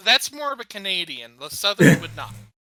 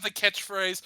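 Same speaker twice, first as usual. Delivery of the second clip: ordinary talk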